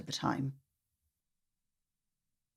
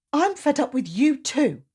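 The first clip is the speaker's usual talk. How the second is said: high, cross sister snapping back; indignant child, pitched up; child's angry retort; 9 semitones higher, 4 dB louder than usual. Acted.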